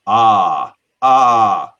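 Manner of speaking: loud bleating "Ah, ah" like a sheep; mock sheep bleat. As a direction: bleat at the crowd like a cow-farm animal. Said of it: "not bleating after the dairy farm joke".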